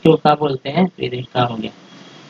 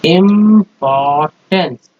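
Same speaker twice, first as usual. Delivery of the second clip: slow blended word; much slower than usual. Shown as a demonstration. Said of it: "hence".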